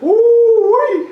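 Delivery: high excited whoop. Real, not acted. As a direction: let out a gleeful whoop. Whooping at the game.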